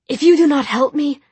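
high, loud, insistent warning; exasperated threat. A narrator's reading. Tessa threatening Jem to make him help, forceful warning. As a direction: lay down a forceful ultimatum.

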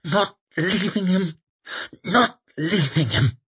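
high, strained hissing, repeated doggedly; stubborn, effortful hiss. A narrator's reading. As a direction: hiss it through gritted teeth while heaving a weight. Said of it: "hissed Temple".

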